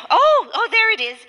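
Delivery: sudden high, excited "oh"; delighted discovery. Acted. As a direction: exclaim as you finally spot it. Mother finally finds the key.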